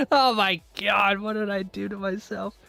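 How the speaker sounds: ordinary delivery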